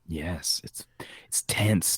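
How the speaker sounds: tense whisper. Acted. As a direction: hush it, build suspense. Whispering about tense scene.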